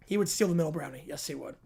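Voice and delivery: usual speaking voice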